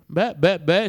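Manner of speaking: husband shouting his wife out of the room. Acted. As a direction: shoo her off, loud. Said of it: "si mise a gridare Zahar".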